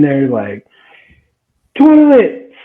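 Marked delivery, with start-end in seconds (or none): 1.8-2.5 very high, loud, drawn-out mock yell. Angry sister from the doorway, imitated yelling. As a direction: holler the word in a stretched, screechy imitation. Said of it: "they're yelling back and forth".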